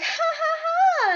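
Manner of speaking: high, mocking ha ha ha; mocking friends. Friends laughing after making her jump, mocking high laugh. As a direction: cackle high like teasing friends.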